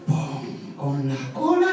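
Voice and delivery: "pon" grave y apagado. A narrator's reading. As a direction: deja caer "pon". Juego rítmico pin pon. ("pon" grave y apagado)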